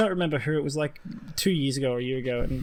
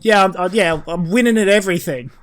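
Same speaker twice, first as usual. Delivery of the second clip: loud, higher, overdone agreement; mock enthusiasm; 5 semitones higher, 7 dB louder than usual. Baffled man answering the slang greeting, mock enthusiastic. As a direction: play along too eagerly. Sarcastic acted reply to slang question.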